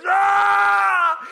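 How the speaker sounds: loud high imitated shriek; spirit's scream. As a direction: scream like a spirit being burned. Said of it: "this spirit goes".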